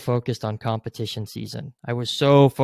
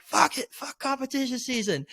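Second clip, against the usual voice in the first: much higher, strained mock scream; second, higher-pitched yell after being told to say it again; retold scream, pushed harder; 13 semitones higher, 4 dB quieter than usual. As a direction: push it up higher on the repeat. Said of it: "I just had to just scream it".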